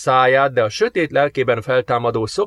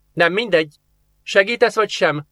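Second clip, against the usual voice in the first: put-on higher, impatient annoyed girl; 8 semitones higher than usual. A narrator's reading. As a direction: stamp her foot and demand an answer. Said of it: "toppantott bosszúsan a lány". (bosszús, türelmetlen, magasabb lányhang)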